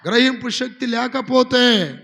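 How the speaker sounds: loud, slow pulpit declaration; booming preacher warning; forceful preaching. Acted. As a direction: hammer it home to the congregation.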